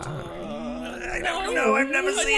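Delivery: high, slow squeaky shout; high-pitched squeal. Acted. Comic high shouted denials.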